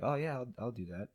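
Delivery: lightly voiced easy yes to the job; eager acceptance. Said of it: "Mexicans will be like".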